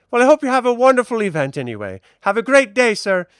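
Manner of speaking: high, fast, loud farewell; fussy seamstress. Acted. Seamstress waving off the admiral, fast and high. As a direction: rush the goodbye brightly.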